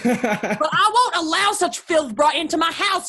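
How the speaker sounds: high, loud, insistent protest; outraged refusal. Acted. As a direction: refuse with mounting vehemence. Mother refuses amid quarrel.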